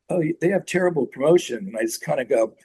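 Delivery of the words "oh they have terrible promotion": higher, matter-of-fact imitation; blunt put-down. Rick Rubin on the new label, mildly mocked bluntness. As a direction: repeat the tactless verdict without cushioning. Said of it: "he just said".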